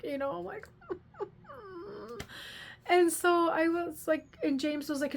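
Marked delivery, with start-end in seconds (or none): none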